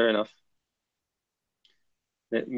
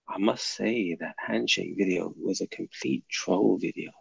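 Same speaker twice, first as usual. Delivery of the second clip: soft, lower imitation of a viewer's accent; Ghazwat al-Rus's accent; 4 semitones lower, 10 dB quieter than usual. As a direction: put on the commenter's accent, low and quiet. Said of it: "let me do it in your, in your accent".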